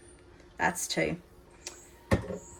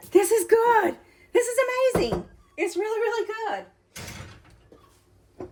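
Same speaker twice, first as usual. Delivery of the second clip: much higher, thrilled praise; delighted; 11 semitones higher than usual. Real, not acted. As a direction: gush over the taste. Delighted reaction after tasting.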